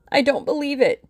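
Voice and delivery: high, loud acted moan; hammed-up wail of distress; moaned disbelief. A narrator's reading. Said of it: "she moaned".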